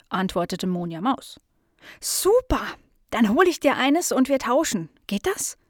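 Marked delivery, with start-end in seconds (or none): 2.0-5.6 high-pitched, eager little dog; delighted. Acted. Nala offers the mouse a swap, delighted. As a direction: burst out happily, keep it high.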